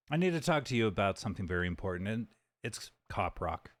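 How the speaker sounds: "I need to talk to you" rattled off fast and low; quick low mumble. Shown as a demonstration. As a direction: rush through it in a low voice. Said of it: "repeated faster and lower".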